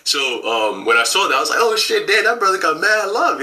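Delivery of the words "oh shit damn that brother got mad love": high, excited retold gasp of awe; a friend marvelling at the warm greeting, pitched high; amazed exclamation. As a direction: blurt it out in wonder, pitched up. Retelling his own amazed reaction.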